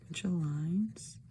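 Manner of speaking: slow whispered aside; slow soft whisper at the page. Real, not acted. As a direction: murmur it unvoiced, slowly. Whispered aside while drawing.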